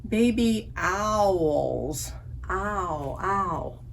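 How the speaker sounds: "owls" stretched slowly, then "Ow, ow" said clearly; ow sound. Shown as a demonstration. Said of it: "Here's a third sound".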